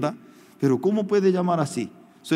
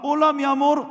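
high, bright-up mock hello, mi amor; lightly put-on friendly shopkeeper; imitated sweet greeting; 11 semitones higher than usual. Shop lady greeting the preacher, higher mock-sweet. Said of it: "dame una Coca-Cola".

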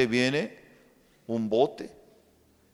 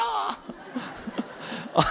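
high unvoiced giggle; high breathy laugh; 4 dB quieter than usual. Real, not acted. Preacher laughs at his own slip, high giggle.

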